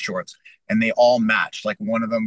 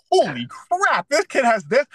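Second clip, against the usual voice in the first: much higher, astonished outburst; stunned surprise; 12 semitones higher, 4 dB louder, slower than usual. Retold or imitated. Fighter recalling sparring shock, astonished high cry. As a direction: caught off guard mid-fight. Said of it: "I'm like".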